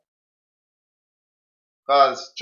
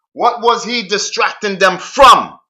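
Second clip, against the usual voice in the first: loud rhetorical question; 5 semitones higher, 6 dB louder, slower than usual. Real, not acted. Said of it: "Asking yourself that now".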